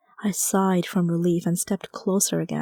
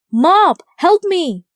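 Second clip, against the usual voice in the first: much higher, louder, slow drawn-out cry for help; panicked shout; 10 semitones higher, 9 dB louder, much slower than usual. Acted. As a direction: yell for the mop while fleeing the rhino. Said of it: "I shouted".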